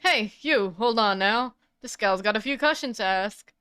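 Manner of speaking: lightly voiced Southern gal calling out; a hint of a Southern accent, hailing someone; Southern drawl. To Phoenix, a Southern gal calls him over. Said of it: "I heard this southern accent before".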